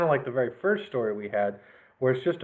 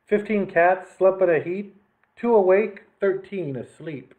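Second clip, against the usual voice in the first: plain reading voice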